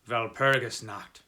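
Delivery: terse German coachman, "Walpurgis Nacht" with a v sound; clipped, lightly voiced coachman; laconic. Coachman answering the traveller, terse and grave. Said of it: "answered laconically".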